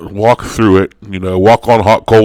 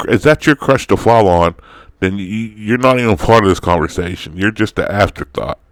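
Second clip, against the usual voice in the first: ordinary delivery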